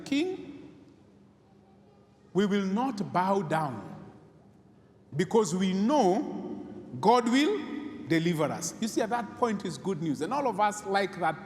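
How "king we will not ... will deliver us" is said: slow, firm, each word weighed; defiant vow. Retold or imitated. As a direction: answer the king slowly and firmly. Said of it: "Abednego tell him".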